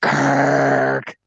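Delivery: lizard alien voice. Retold or imitated. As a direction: drag it out like the lizard guy. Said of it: "he talked like".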